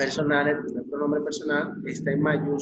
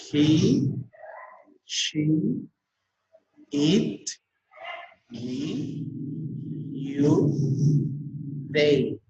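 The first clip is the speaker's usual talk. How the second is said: isolated pronouns, rising one by one; lifted endings until a falling THEY; pronunciation drill; much slower than usual. Shown as a demonstration. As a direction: leave a pause after each word for repeating. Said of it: "Vuelvo y repito".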